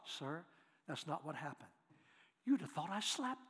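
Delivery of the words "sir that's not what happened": hushed, even answer; calm soft reply. Retold or imitated. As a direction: keep it soft and low to defuse anger. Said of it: "Just like that".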